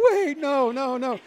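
a hint of high, comic protest; mock panic. Host retelling leaving his cat, mock panicked. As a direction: play the panicked cat owner, lightly. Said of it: "I was like".

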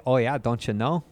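sing-song Midwestern accent; mock Midwestern, Scandinavian-tinged vowels. Shown as a demonstration. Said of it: "The Midwest will say".

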